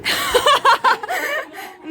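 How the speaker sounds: high, real burst of laughter; laughing. Real, not acted. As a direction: crack up in a high voice. Cracks up at weight joke. (ज़ोर से खिलखिलाकर हँसना)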